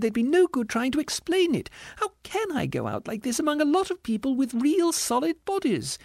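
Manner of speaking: very high indignant ghost voice; distressed squealing ghost. A narrator's reading. Ghost rebuking the spirit, squealing indignantly. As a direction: plead indignantly, far up high.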